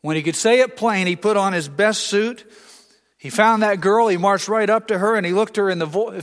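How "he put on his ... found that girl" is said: usual speaking voice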